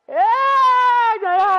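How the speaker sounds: sudden loud yell of joy; excited shout. Real, not acted. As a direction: burst out with a loud yeah. Big in-game moment.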